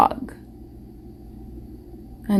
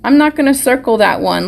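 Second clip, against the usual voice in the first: ordinary talk, nothing marked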